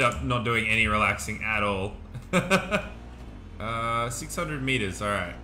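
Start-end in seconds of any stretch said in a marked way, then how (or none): 2.0-3.5 breathy, voiceless chuckle; laughter. Real, not acted. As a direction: laugh at your own bad habit. Laughs at own work habit.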